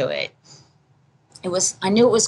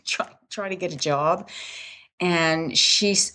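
ordinary delivery

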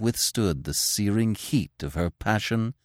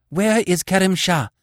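out of breath, high and loud; gasping; 11 semitones higher, 4 dB louder than usual. Acted. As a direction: gasp it out after a long run. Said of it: "she gasped, panting after her long run".

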